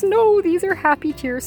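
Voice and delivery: reassuring mom. Retold or imitated. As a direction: soothe her little girl, lightly. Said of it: "And she goes".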